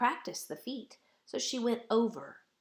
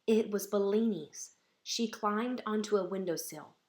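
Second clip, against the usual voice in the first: usual speaking voice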